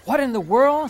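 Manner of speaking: loud, high cry of disbelief; stunned outburst, loud and high; shocked. A narrator's reading. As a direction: gasp it out in shock. Josefina shocked at tortilla poster.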